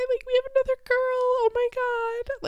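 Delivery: re-enacted happy tears; retold happy crying. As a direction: squeak it out, overcome with joy. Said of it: "and we were just crying".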